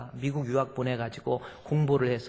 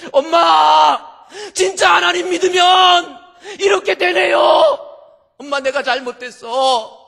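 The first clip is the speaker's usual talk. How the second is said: high, loud wailing cry; sobbing daughter; 13 dB louder than usual. Acted. Daughter weeping before her mother, loud wailing cry. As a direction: shout it through tears. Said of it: "펑펑 울면서".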